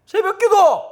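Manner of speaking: high, very loud outburst; exasperated shout. Real, not acted. Preacher to congregation on dawn prayer meetings, exasperated shout. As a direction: shout it out as the crowning grievance. Exclaims over uniquely Korean practice.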